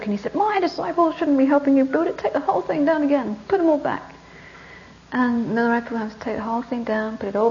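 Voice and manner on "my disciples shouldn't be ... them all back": higher-pitched indignant rebuke; mock scolding. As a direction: act out a teacher's stern telling-off. Teacher scolds disciple in retold story.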